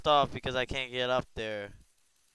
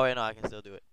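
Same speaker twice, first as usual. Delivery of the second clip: ordinary delivery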